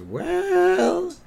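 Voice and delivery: very high, drawn-out squeak; hesitant hedging. Retold or imitated. Bart dodges his dad's worried question, high hesitant drawl. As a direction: stretch it out high and noncommittal.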